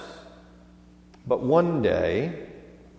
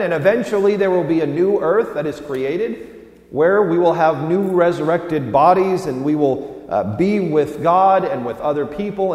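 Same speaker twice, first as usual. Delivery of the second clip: rising, louder preacher's cry of hope; preaching at full force; 4 semitones higher, 4 dB louder than usual. Acted. Preacher promising reunion to the congregation, full-force preaching. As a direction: lift the room toward heaven.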